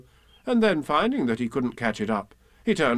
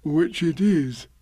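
slow, higher bear afterthought; Pooh, musing; 4 semitones higher, slower than usual. A narrator's reading. Bear confirming his own name, slow musing. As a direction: add it slowly to yourself. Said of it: "he added".

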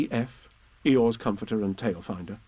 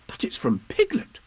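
excited whisper; 12 semitones higher than usual. Acted. Christopher Robin reading Piglet's message.

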